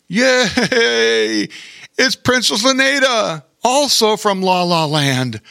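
high, gleeful cheer; excited. A narrator's reading. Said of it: "said Bear King excitedly".

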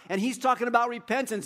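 normal talking voice

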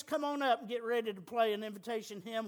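normal talking voice

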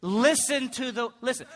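put-on praying, slightly lifted; prayer voice. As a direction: call out as if in prayer. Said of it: "That's his prayer voice".

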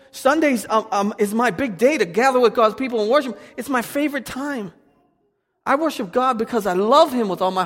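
high, loud preaching at full force; fiery preaching. Acted. Pastor urging the church to love God not fear, loud fiery preaching. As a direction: preach it to the back row.

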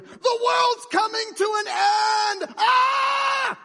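very high, drawn-out hysterical shriek; panicked doomsday scream. Acted. Preacher mimicking the news and social media, in a panicked scream. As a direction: scream it in mock end-of-the-world panic.

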